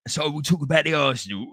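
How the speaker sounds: put-on broad Cockney; Cockney accent. Shown as a demonstration. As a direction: lay on the Cockney for a demo. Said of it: "I can talk like a Cockney".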